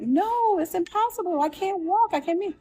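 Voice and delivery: high, insistent disbelief; retelling a panicked objection, pitched high; distressed protest. Retold or imitated. Patient insisting to the doctor she can't walk, high distressed protest.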